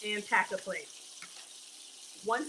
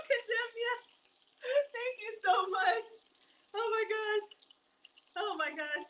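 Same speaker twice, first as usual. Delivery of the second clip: high-pitched, excited gratitude; overwhelmed thanks; 11 semitones higher than usual. Real, not acted. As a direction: burst out laughing, then gush in a high voice, easing off. Thanks viewer for surprise gift.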